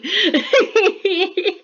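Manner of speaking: a high, loud burst of giddy laughter. Real, not acted. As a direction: laugh out with pure joy. Giddy after finishing the piece.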